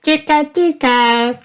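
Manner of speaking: put-on very high, teasing toddler chant; little George. A narrator's reading. George tickles Grandpa as a spider, high teasing voice.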